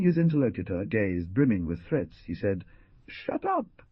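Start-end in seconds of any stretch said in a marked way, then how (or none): 3.1-3.8 sharp outburst. A narrator's reading. Young man silencing his insulting companion, sharp shout. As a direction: snap it out, much higher. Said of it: "stood upright as if bitten by a serpent".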